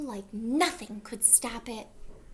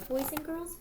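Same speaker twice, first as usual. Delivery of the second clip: ordinary delivery, nothing marked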